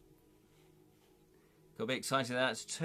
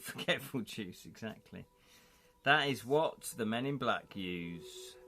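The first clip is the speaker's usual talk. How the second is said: usual speaking voice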